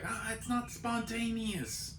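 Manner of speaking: high, slow mock Australian accent; jokey Australian accent, pitched high. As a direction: drawl it in a silly Australian accent. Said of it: "they turn Australian".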